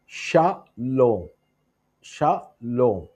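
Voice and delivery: ഷലോം very slowly, shaa, pause, lom; syllable by syllable. Shown as a demonstration. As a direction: stretch ഷലോം into two beats. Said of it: "ചേർത്ത് പറയുന്ന മൂന്നാമത്തെ വേർഡാണ്". (ഷലോം രണ്ടായി നീട്ടി, പതുക്കെ)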